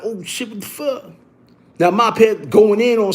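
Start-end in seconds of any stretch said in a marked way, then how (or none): 0.0-1.0 hushed reaction. Retold or imitated. Quiet retold reaction.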